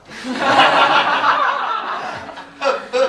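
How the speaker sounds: high, loud burst of laughter. Real, not acted. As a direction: crack up at the slip. Laughing after the quote.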